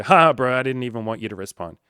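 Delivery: loud scoffing bro, then fast; loud smug troll brush-off; mocking troll. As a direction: bark a dismissive laugh. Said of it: "and then you go".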